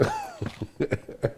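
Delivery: sudden high burst of laughter. Real, not acted. Remembering the smoke session, high laughing burst. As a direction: break into laughing.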